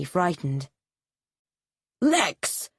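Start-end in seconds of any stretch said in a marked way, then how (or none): 2.0-2.7 higher, sharp call of a stern father; father shouting. A narrator's reading. Father shouting at his scared son. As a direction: call the boy's name like an angry father. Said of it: "shouted his father".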